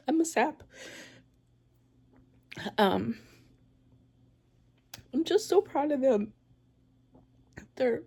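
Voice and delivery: real happy tears breaking through the words; tearful. Real, not acted. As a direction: voice tight and high from crying with pride. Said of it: "Sorry for my ugly ass crying".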